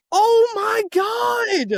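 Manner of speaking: very high, slow, loud cry of disbelief; shocked shout. Retold or imitated. Reacting to the spoiled death, shrieking high and slow. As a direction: stretch the oh my god out in outrage. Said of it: "And I went".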